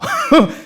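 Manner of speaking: high burst of laughter; laughing at his own impression. Real, not acted. Laughs mid coach impression.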